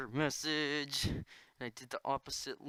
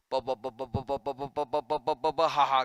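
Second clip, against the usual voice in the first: rhythmic bu-bu chant; 9 dB louder, faster than usual. Pretend. Rhythmic bu sounds.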